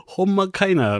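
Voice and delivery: put-on high Kansai accent quip. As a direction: toss it off in Kansai dialect. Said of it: "みたいな".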